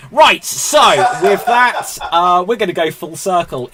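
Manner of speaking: high, loud host voice; calling to order. Real, not acted. Host moving the game on, loud and high. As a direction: take back the show with a shout. Host takes back the show loudly.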